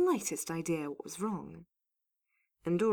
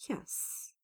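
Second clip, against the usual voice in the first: very soft, whispered yes; tight, voiceless answer; strained reply; 9 dB quieter than usual. A narrator's reading. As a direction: force out a bare whisper. Pained patient answers through gritted teeth.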